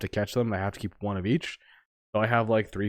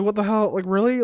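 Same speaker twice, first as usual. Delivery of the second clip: incredulous; 10 semitones higher, 4 dB louder than usual. Retold or imitated. Reacting to Alakazam encounter.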